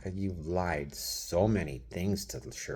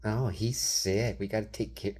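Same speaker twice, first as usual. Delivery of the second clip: mocking her; 5 semitones higher than usual. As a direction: mimic her fake concern. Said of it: "mocking her".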